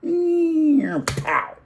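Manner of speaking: high, loud, drawn-out engine whine into a pow; slow-mo racing zoom. Acted. Storyteller likening photo swaps to nascar, imitated slow-mo zoom. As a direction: imitate a race car whizzing by in slow motion. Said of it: "in slow-mo".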